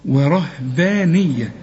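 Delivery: ورهبانية said slowly with a clean ha; drawn out ورهبانية, no swallowed ha; ورهبانية read slowly, ha sounded fully; slow correct reading. Shown as a demonstration. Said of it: "ما تقولش وره".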